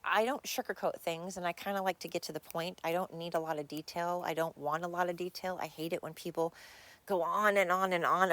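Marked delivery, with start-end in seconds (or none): none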